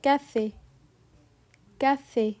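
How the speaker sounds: "café" with a sharp closed é at the end; French é. Shown as a demonstration. Teacher models the word for students, sharp closed é. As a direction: say "café" the French way, ending on a tight ay. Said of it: "For example".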